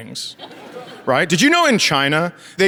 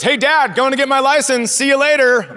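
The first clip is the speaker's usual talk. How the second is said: put-on higher, breezy teen voice; cheerful daughter; 7 semitones higher than usual. Daughter leaving for her driving test, breezy. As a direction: sing it out light as you leave. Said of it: "she goes".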